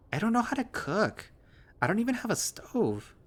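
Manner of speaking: high, whining craving for pastries; whiny girlish voice. Acted. Friend groaning over turnovers, high girlish whine. Heather denies cooking.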